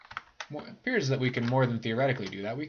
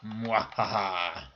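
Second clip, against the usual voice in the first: evil laugh; much slower than usual. Pretend. Gloating after killing snakes.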